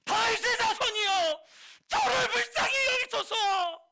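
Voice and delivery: full-force screamed plea, very high; ear-splitting drawn-out shout for mercy. Shown as a demonstration. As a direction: bellow it desperately, dragging out the words. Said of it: "크게 외쳤다라고 했어요".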